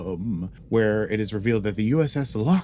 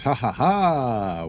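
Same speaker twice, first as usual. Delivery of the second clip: mock laugh; 4 semitones higher, 5 dB louder, much slower than usual. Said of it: "back with my normal voice".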